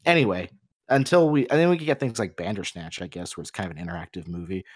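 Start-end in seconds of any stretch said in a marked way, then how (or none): none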